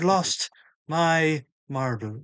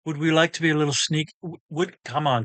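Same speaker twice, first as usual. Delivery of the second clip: normal talking voice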